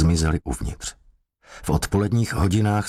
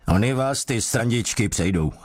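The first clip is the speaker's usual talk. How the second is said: higher, biting threat; angry threat; 6 semitones higher than usual. A narrator's reading. Sergeant losing patience with the suspect, angry higher threat. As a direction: spit the warning out in anger. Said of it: "odhodil strážmistr vztekle tužku".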